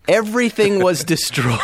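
laughing through the line; giggling while telling it. Real, not acted. Gleeful retelling of ship fire.

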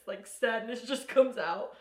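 ordinary talk

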